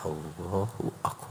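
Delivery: whispered, breathy takbir. Real, not acted. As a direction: breathe it out in awe. Whispered takbir illustrating prayer.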